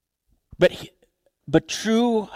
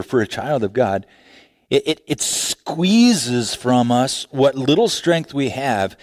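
ordinary delivery

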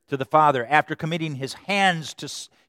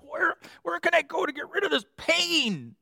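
mock anguished plea. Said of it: "It's like".

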